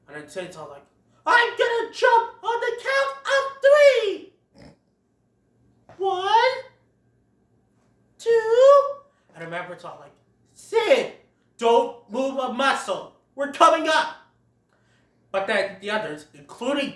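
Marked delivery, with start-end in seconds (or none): 1.3-9.0 high, loud shout from up high; showoff shout. Retold or imitated. Sid calling down from the ledge, high loud shout. 10.5-14.2 urgent call. Retold or imitated. Manfred calling up to Sid, loud and high.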